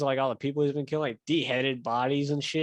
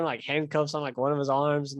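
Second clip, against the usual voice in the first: ordinary talk, nothing marked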